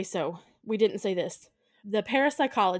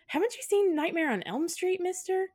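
put-on high, cheeky kid asking; bratty kid voice; 10 semitones higher than usual. Kids sassing the adult, high cheeky kid voice. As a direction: play the smart-mouthed kid, pitched up. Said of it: "They're like".

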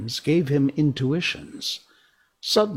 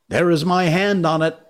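tag; 7 semitones higher, 6 dB louder than usual. Acted. Pirates swearing an oath.

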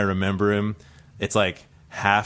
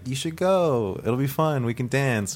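higher, lightly imitated coaxing; 6 semitones higher, slower than usual. His date talks him into coming to the club, higher coaxing. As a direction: voice a date lightly talking you into it.

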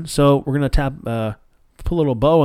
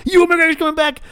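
much higher, squealing with joy; fan squeal; 4 dB louder than usual. Retold or imitated. Fan repeating the news, now squealing with excitement. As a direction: let it burst out in a giddy shriek. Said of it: "I'm like".

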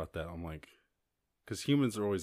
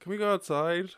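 hopeful plea; 10 semitones higher, 6 dB louder than usual. Retold or imitated. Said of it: "now it's like".

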